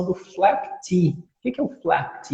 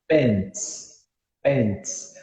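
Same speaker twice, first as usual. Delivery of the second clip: American English; 3 semitones lower, 4 dB quieter than usual. Shown as a demonstration. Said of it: "as calças em inglês americano".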